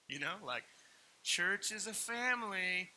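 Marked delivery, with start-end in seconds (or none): 1.2-2.9 slow, high, stretched-out quote; drawn-out slogan. Retold or imitated. Pastor quoting the church-as-family line, drawn out.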